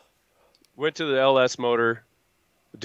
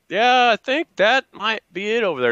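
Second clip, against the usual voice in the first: higher, hopeful voice pointing it out; high hopeful guess; 11 semitones higher than usual. Retold or imitated. At the parts counter, pointing, higher and hopeful. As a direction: lift the voice hopefully. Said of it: "and I'm like".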